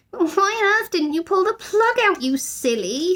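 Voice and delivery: put-on high teasing girl; high girl's affectionate tease; fond scolding. A narrator's reading. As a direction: chide him gently. Judy scolding fondly.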